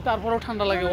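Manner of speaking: normal talking voice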